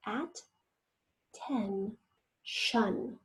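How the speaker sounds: At, ten, shun said softly with pauses between; teacher chunking the word; syllables separated. Shown as a demonstration. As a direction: split the word into three soft beats. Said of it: "first syllable".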